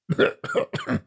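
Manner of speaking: a hard, rasping cough that won't stop; acted coughing fit. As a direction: cough like a drunk man choking on damp air. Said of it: "How long have you had that cough?".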